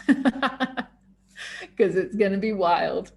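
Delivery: slow words through a laugh. Real, not acted. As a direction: laugh it out, drawn out. Laughs at closing promise.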